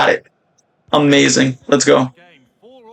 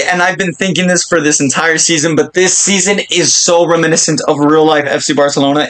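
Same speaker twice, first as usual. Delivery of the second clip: ordinary talk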